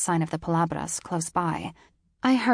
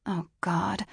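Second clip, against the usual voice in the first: ordinary delivery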